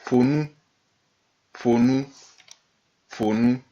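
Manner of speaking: "Pour nous" with P puffed like blowing out a candle; blown P. Shown as a demonstration. Teacher demonstrates the special P, "Pour nous" with a blown onset. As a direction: blow through the lips to start "Pour nous". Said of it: "C'est comme si on souffle".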